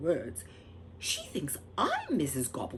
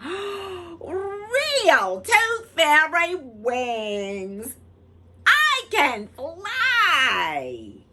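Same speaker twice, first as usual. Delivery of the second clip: thrilled shout; 13 semitones higher, 13 dB louder, much slower than usual. Acted. Gobblecracker thrilled.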